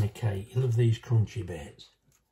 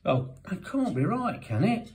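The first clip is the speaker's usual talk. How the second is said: retold disbelief; 10 semitones higher than usual. Recalling reading the recipe, high and disbelieving. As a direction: repeat it like it can't be true. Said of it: "But I thought".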